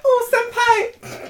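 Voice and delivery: put-on very high squeaky anime girl. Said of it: "sounding like an anime girl".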